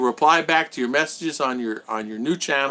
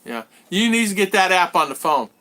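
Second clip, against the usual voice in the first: normal talking voice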